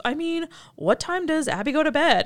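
high, awkward stalling question; mock nervous father; lightly hammed-up flustered dad. Matthew stalling, asking about bedtime.

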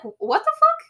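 very high, loud mock outburst; stunned disbelief. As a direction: squawk it in acted-out shock. Acting out Bailey's stunned reaction.

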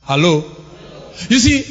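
normal talking voice

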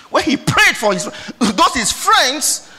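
ordinary delivery

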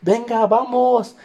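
rile the crowd up like a fighter; hyped yell. Retold or imitated. Stepping out of the car to the crowd, high whooping yell. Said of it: "empecé a gritar".

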